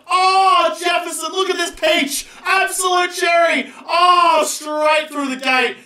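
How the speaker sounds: high, slow, thrilled praise; excited shouting. Real, not acted. Gamer hails his bowler Jefferson, shouting with glee. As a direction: savour every word of the wicket. Celebrating the wicket.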